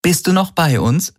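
high, teasing question; lilting, lightly mocking check-in; amused. A narrator's reading. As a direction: ask it playfully, pitched well up. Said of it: "sagte sie erheitert".

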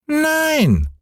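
hohe, quengelnde Kinderstimme; kleiner Junge schreit. Acted. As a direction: fordernd wie ein ungeduldiges Kind. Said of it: "schrie Häwelmann". (hoch und fordernd gerufen)

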